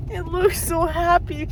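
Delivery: half laughing, half crying, high-pitched; laughing through real tears over the dog; tearful laughing. Real, not acted. To her friend about the happy dog, laughing through tears. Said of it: "I'm about to start crying".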